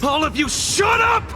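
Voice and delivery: high, exasperated shout; furious yell climbing at the end; angry shout. Acted. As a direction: shout the crowd down. Silencing the shouting crowd.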